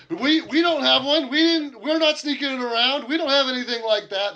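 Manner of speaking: scared prisoners squealing their denial; panicked denial. Acted. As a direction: plead innocence in a rising panic.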